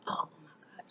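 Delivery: breathless panicked whisper. Retold or imitated. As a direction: whisper it in sudden worry.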